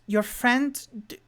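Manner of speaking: a hint of a doubting woman; suspicious halfling woman. Halfling woman doubting the party's story, mildly high. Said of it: "the halfling woman goes".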